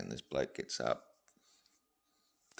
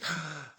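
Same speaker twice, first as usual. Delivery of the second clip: delighted gasp; 9 semitones higher than usual. Acted. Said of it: "And she goes".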